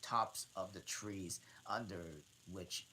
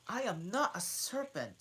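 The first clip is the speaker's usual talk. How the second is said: high, loud indignant protest; 12 semitones higher, 8 dB louder than usual. Acted. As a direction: protest it, offended. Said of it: "Alice indignantly".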